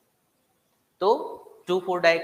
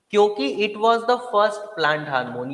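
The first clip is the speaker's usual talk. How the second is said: slow, louder, each word hammered; emphatic and slow; 4 semitones higher, 4 dB louder, much slower than usual. Shown as a demonstration. Teacher stressing an exam fact, slow and loud. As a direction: drive home the point word by word. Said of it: "बड़ा important है ये".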